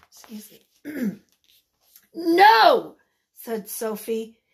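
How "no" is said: loud, high story-character refusal; defiant shout. Acted. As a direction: belt out an indignant refusal.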